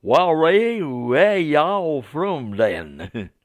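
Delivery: hammed-up Southern drawl, high and loud; Southern American accent. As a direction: drawl it slowly like an over-friendly Southern preacher. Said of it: "my poor attempt at a Southern American accent".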